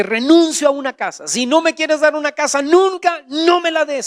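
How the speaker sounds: high, firm, acted-out surrender; emphatic renouncing. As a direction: declare it to God with resolve. Acts out his defiant surrender to God.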